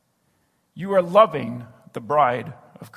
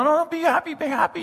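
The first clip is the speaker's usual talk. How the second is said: mock coaxing a child; 11 semitones higher, faster than usual. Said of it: "tell a crying child to be happy".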